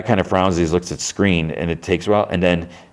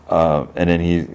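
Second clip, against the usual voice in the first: ordinary delivery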